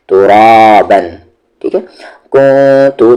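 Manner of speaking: ordinary talk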